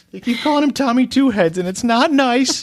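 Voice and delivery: high, loud, slowing whine; mock whiny father. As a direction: whine it like a hurt dad.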